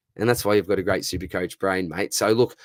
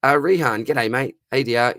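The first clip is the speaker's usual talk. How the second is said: ordinary delivery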